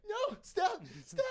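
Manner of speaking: high-pitched retold squawk of protest; mock protest. Imitating partner fighting off a wet willy, high mock protest. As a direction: squawk it, imitating someone fending off a tease.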